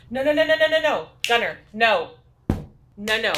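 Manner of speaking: loud urgent telling-off of Gunner; firm scolding. Real, not acted. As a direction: stop the puppy right now. Scolding puppy on pee pads.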